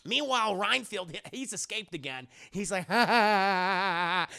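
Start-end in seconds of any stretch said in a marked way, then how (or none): 2.9-4.3 loud, slow hammed-up madman laugh; crazy cackle. Renfield has escaped, crazy mock laugh. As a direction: cackle like a comic lunatic. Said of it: "He's acting all crazy and shit".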